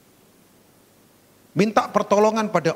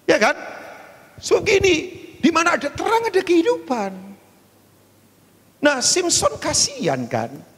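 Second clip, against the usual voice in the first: preaching peak; 13 semitones higher than usual. Acted. Preaching climax.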